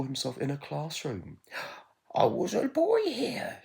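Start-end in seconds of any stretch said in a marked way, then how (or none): 2.1-3.6 much higher, slow wistful voice; wistful whisper. Acted. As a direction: say it high and wistfully. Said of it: "he whispered".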